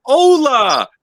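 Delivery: high, loud sung hola; Spanish stomach greeting. Retold or imitated. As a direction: belt the hola out like a cartoon stomach. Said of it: "He goes".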